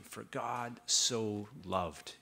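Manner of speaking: "For God so loved" said very slowly, each word weighted; slow emphasis. Shown as a demonstration. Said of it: "why I'm emphasizing this".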